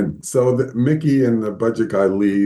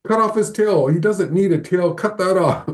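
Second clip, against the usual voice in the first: much higher, sharp retold order; mock angry boss; 9 semitones higher than usual. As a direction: play the boss barking to cut the tail. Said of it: "he's all mad at me".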